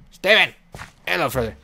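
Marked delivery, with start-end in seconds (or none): 0.1-0.5 loud, raised-pitch name call; loud exasperated shout. Pretend. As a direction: call out sharply to a stubborn pet. Calling stubborn animal to move.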